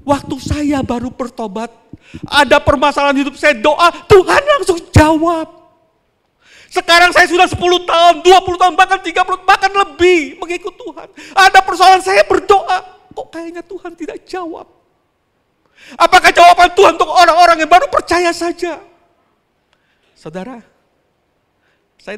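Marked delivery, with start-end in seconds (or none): 0.0-14.7 loud, high, fast lament; long-time believer's complaint. Retold or imitated. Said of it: "ada beberapa orang katakan". 15.9-18.8 loud, high rhetorical question; preaching at full force. Acted.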